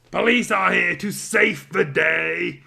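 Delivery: heroic announcement. Acted. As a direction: announce it like a cartoon hero. Police burst in heroically.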